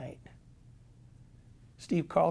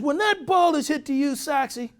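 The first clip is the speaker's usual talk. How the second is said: high, loud exasperated manager; high, angry manager voice; 11 semitones higher, 7 dB louder than usual. Retold or imitated. Manager berating his players over the book, high and loud. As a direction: demand it in a raised, exasperated voice. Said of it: "He says".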